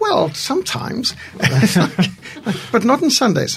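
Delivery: high, amused retelling with a laugh; laughing reply. Retold or imitated. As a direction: tease it out with a chuckle. Retelling his cheeky reply, laughing.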